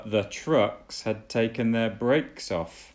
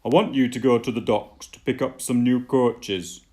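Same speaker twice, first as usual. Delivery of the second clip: louder, firm boss voice giving orders; ordering Fat Controller; 3 semitones higher, 7 dB louder than usual. Acted. Fat Controller ordering Thomas to the docks, firm and loud. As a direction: issue the order like a stern railway boss. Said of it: "he ordered".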